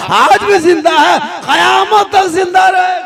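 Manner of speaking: builds to a loud, high cry; rising shout. Real, not acted. Preacher's rising declaration. (ऊँची आवाज़ में ज़ोरदार ऐलान)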